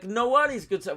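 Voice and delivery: high, loud put-on Italian; mock Italian accent. Customer accidentally answering the Italian vendor in his accent, mock Italian. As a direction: slip into the vendor's hammy Italian. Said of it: "Wait, no, I'm not Italian".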